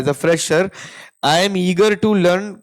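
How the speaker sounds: slow, careful model answer; model interview answer. Shown as a demonstration. Teacher repeating the model answer, slow and careful. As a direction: read the sample line slowly. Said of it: "eagerness दिखानी है".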